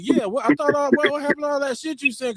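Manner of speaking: high, taunting cousin voice; mocking cousin. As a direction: throw his own words back at him, up high. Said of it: "she called me on it".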